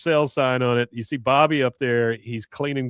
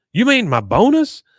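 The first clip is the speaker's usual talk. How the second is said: high, louder disbelieving question; imitating a baffled dad, pitch leaping up; mock incredulity; 9 semitones higher, 5 dB louder than usual. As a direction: repeat it back in acted astonishment. Acts out Hank's incredulous correction.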